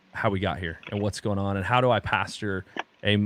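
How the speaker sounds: ordinary delivery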